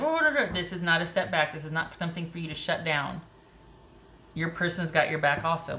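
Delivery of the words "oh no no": high, mock-panicked protest; mock scared. Reader voicing the scared listener's doubts, mock scared. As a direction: act out a flustered little scare. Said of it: "if you're scared and you're like".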